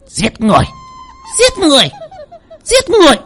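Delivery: panicked bird shrieking murder; parrot screeching. Acted. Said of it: "Nó vừa kêu".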